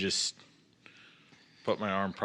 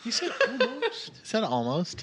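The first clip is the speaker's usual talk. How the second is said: laughing tease; 13 semitones higher than usual. Real, not acted. Repeating the joke laughing.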